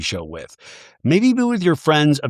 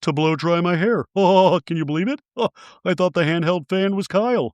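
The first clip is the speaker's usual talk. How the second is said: a lightly put-on, higher gossipy voice; imitating Kathy; 4 semitones higher than usual. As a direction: play the delighted friend, slightly higher. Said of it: "And Kathy's like".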